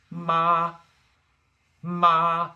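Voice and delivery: long, loud, high level MA; first tone. Shown as a demonstration. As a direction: sing out MA on one high, flat pitch. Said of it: "kick it off with the first tone".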